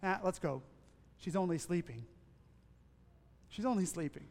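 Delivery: soft, reassuring words; quietly calm the worried crowd; gentle comfort to the grieving family; calm Jesus. Retold or imitated. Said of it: "what does Jesus say".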